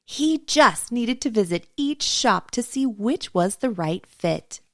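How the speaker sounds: ordinary delivery, nothing marked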